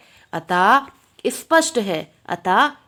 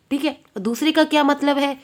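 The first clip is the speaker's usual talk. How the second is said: ordinary talk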